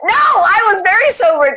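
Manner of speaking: loud, high denial; indignant protest. Real, not acted. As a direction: protest the accusation loudly. Protests being called drunk that day.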